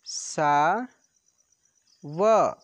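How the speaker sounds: चा and र said apart with long pauses; syllable by syllable. Shown as a demonstration. Teacher spelling out syllables for pupils, slow and separate. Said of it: "तो ऐसे र में छोटी की मात्रा रि".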